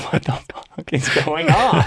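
stunned by the candlelit room, laughing; laughing disbelief. Retold or imitated. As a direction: blurt it out between laughs.

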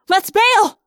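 high, loud shout to flee; full-voiced cry of alarm; urgent yell. A narrator's reading. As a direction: yell for everyone to escape. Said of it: "I yelled".

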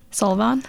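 Sullivan as SOL-vahn, rising like a question; unsure pronunciation. Real, not acted. Reader doubting how to say the name, questioning pronunciation.

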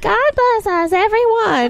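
put-on high squeaky kid quote; tiny child voice. Co-host quoting the boy, high child voice.

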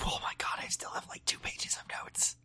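barely voiced, slowing whisper; hushed aside of dread at the workload; whispered dismay. Real, not acted. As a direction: whisper it under your breath. Realising how much is left.

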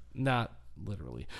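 usual speaking voice

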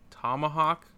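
ordinary delivery

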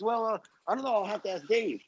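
high, unsure dithering; hesitant manager. Retold or imitated. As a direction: dither like you're stalling. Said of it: "He goes".

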